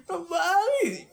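high, laughing cry; half-laughing whimper; mock sob. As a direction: wail it through laughter. Retold crying as child.

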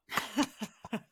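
maniacal laugh. Retold or imitated. Jack's mocking laugh.

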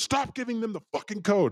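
high-pitched mock yell of the owner; retold sharp order in a high voice; imitated scolding.

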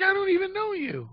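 high, mock-scandalized woman's squeak; flustered woman. Woman protesting to a stranger, high mock shock. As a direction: play her shocked protest, high and hammed. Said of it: "She's like".

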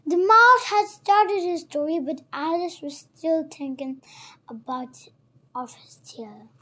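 ordinary delivery

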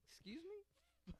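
very high, slow mock offense; mock indignant squeak. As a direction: squeak it out in fake shock.

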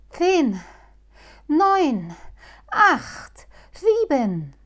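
high, loud rocket countdown; excited countdown. Acted. Halbschuh counting down to the mouse's escape, high and excited. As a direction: count down like a launch, thrilled. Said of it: "zählte Halbschuh wie bei dem Start einer Rakete".

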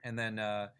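ordinary talk

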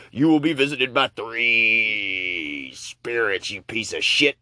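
slow, drawn-out booming ghost voice; ghostly warning. Acted. Chained ghost warning the miser, slow and booming. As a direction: intone it like a doomed spirit.

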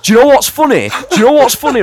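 can't believe it, getting loud; high loud disbelief; excited. Real, not acted. Excited outburst laughing at story.

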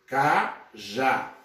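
"cajá" slowly split as ca, pause, já; syllable by syllable. Shown as a demonstration. Teacher dividing cajá for students, syllable by syllable. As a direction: break "cajá" into two separate, drawn-out syllables. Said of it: "dividimos em sílabas aqui".

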